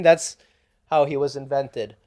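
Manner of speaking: normal talking voice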